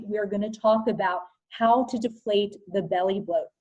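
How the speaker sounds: usual speaking voice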